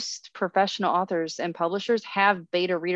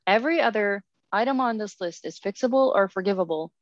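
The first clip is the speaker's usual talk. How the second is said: usual speaking voice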